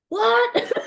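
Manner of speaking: high squeaky laughing what; baffled laughing what. Retold or imitated.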